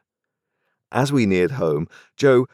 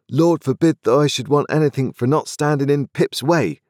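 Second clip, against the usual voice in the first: higher, earnest blacksmith voice; a plain man, firmly offended; indignant Joe; 9 semitones higher, 5 dB louder than usual. A narrator's reading.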